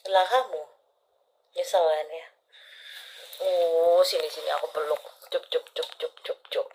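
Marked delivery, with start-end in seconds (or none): 3.4-6.7 tender cooing comfort; soothing. Real, not acted. Comforting crying client.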